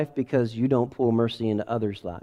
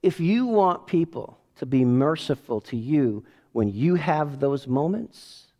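normal talking voice

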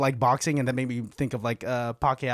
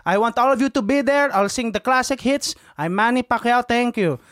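put-on higher Filipino-accented announcement; Manny Pacquiao; 4 semitones higher than usual. Boxer advertising his concert after a fight, imitated Filipino accent. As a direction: plug your show in a Filipino accent. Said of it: "he would advertise".

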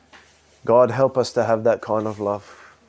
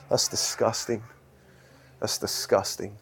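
quiet disgust; 3 semitones lower, 7 dB quieter than usual. Acted.

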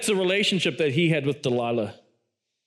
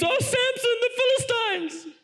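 mock scream. Delilah screams to Samson about the Philistines, mock shriek. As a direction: pitch it way up like a comic cry of alarm. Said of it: "And she screams".

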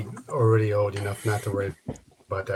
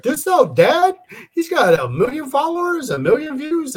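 imitating a kid in a much higher voice; eager son; 3 dB louder than usual. Son urging dad to watch a YouTuber, eager. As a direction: mimic a child excitedly selling dad on it.

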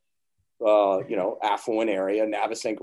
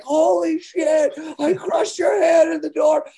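much higher, louder, slow horrified yell; panicked shout; 7 dB louder, slower than usual. Retold or imitated. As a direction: scream in shock at what you did. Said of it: "I'm like".

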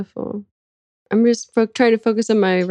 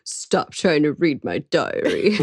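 slow, lower put-on voice; mock scribe; 4 semitones lower, slower than usual. Scribe warning off diary readers, slow mock complaint. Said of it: "He's also like".

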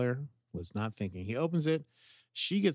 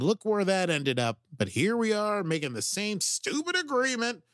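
higher, frustrated complaint; fed up with their choices; exasperated; 8 semitones higher, 4 dB louder than usual. Real, not acted. Exasperated at characters.

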